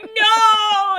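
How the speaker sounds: put-on high, loud childish wail of no. Mother imitating a little girl wailing no. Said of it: "and she's like".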